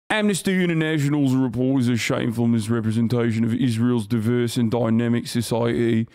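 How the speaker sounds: mock posh British accent reading a statement. Streamer mocking the UK statement in a British accent. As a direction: read it like a smug British spokesman. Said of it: "British accent".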